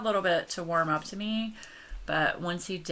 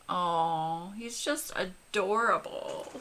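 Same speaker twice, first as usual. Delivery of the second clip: ordinary talk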